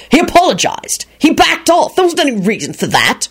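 high, indignant protest; angry bark. A narrator's reading. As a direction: protest angrily on the goblin's behalf. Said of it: "Lupin barked out angrily".

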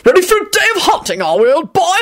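high-pitched jolly hail, quick start; happy yell. A narrator's reading. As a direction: call it out heartily and cheerfully. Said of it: "Richard yelled out happily".

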